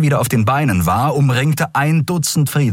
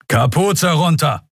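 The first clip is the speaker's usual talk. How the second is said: higher, slow commanding order; thundering order; 8 semitones higher than usual. Acted. The lieutenant orders Kai to unhood, thundering. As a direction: thunder it like an officer. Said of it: "donnerte der Leutnant".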